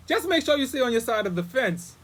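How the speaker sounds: imitating the neighbor, high and loud; high-pitched retold telling-off; mock stern warning. Neighbor warns the guest before going inside, mock stern.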